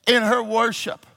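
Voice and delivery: high, loud sermon cry; full-force preaching. Real, not acted. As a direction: preach it loud, building to a long held shout.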